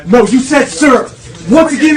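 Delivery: ordinary talk, nothing marked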